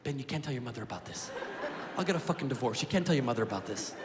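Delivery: low, hushed, anxious pleading; nervous dad. Retold or imitated. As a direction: beg quietly and low like a scared father.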